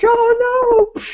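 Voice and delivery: very high comic cry; mock off-screen panic. Someone off screen as windows break, mock panicked cry. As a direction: cry out high in exaggerated alarm. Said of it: "off screen it's like".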